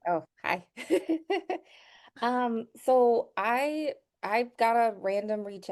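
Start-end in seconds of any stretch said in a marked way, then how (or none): 0.7-1.6 high-pitched giggle; greeting laugh. Real, not acted. Guest joining the live call, high giggle. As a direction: giggle brightly on being greeted.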